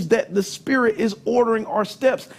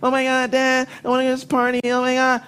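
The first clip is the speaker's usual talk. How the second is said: whiny daughter; 3 semitones higher, much faster than usual. Retold or imitated. Daughter begging again.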